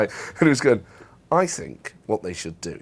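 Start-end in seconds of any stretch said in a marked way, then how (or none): 1.3-2.8 a lightly voiced smug bloke, lower and slow; a hint of a know-all toff; smug middle class guy. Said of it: "really smug middle class guy".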